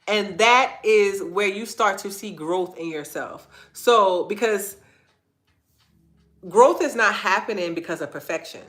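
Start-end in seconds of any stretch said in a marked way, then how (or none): none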